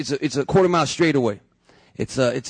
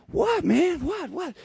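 much higher, confused squeak of a question; baffled, high-pitched what; 12 semitones higher, 4 dB quieter, much slower than usual. Retold or imitated. Narrator answers the yelling cop, bewildered and high-pitched. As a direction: sound totally lost and thrown.

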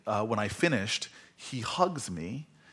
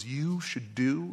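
drawn-out, suspenseful words of wisdom; slowly dole out the advice, leaving it hanging; imitating a sage's hushed, slow delivery; quiet, portentous; 3 semitones higher, much slower than usual. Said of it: "she says it very quietly".

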